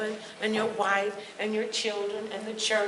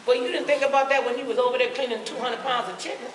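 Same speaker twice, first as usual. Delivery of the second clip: usual speaking voice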